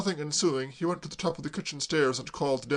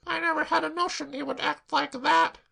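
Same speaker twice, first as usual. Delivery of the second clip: put-on tearful girl; 14 semitones higher, 3 dB louder than usual. Claire sobbing to Braddock, high tearful wail.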